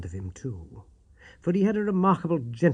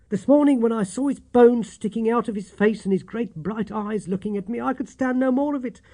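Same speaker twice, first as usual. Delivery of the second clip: put-on high, anxious old landlady voice; a worried housekeeper pouring out her fears; distraught landlady; 8 semitones higher, 4 dB louder than usual. A narrator's reading. Landlady telling Watson of the dying Holmes, voice pitched high and anxious.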